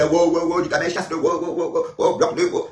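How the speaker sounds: high mock huffing, wow wow wow. Mocking Ducamel's huffing while he fumed, high wows. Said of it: "Pendant qu'il soufflait".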